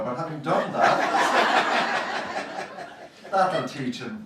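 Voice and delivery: giggling gleefully at her own jibe; laughing. Real, not acted. Poet laughs at her jab at the reviewer, giggling.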